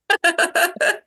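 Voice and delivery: bright happy laugh, pitch jumping up; high delighted laugh. Real, not acted. Guest dreaming of Barranquilla's carnival, high delighted laugh. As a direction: laugh out with real joy at the memory.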